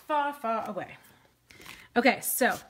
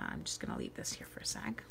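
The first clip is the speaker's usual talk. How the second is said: unvoiced murmured aside to herself, much softer; whisper; 14 dB quieter than usual. Real, not acted. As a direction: drop to a breathy whisper while concentrating. Quiet aside while crafting.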